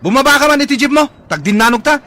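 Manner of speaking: high, loud yell to get off the jeep; furious shouted dare. Acted. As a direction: scream the dare at him. Passenger dares driver off jeep.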